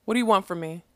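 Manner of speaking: usual speaking voice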